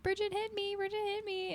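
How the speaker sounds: high-pitched whiny fake wailing; mock child crying. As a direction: wail it out like a tattling little kid. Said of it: "crying in her room".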